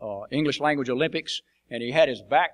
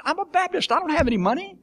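mock indignant retort; 4 dB louder than usual. Retold retort to the official.

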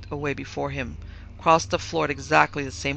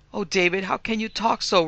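sobbing; 5 semitones higher, 4 dB louder than usual. A narrator's reading. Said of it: "sobbed her mother".

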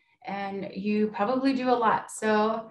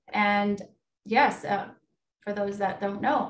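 ordinary talk

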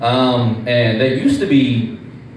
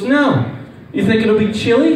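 put-on girlfriend's innocent question; 8 semitones higher than usual. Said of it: "She goes".

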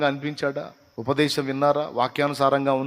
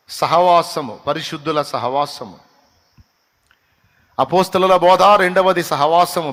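loud, raised preacher's call; preaching at full force; 3 semitones higher, 7 dB louder than usual. Acted. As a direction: announce the points to the congregation, louder. Listing points at full force. (గట్టిగా ప్రసంగం)

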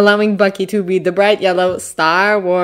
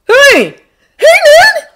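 very high, loud hail; squealed boyish hello; excited greeting; 9 dB louder, slower than usual. A narrator's reading. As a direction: greet your friend in a high kid voice. Boy greets arriving friend.

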